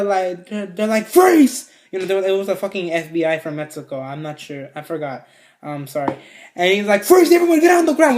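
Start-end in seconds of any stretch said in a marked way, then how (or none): 1.1-1.7 high, loud retold command; mock police order; police shout. Acting police bursting in. 7.0-8.2 high, loud barked order; acted-out urgent police command; police shout. Acting police bursting in.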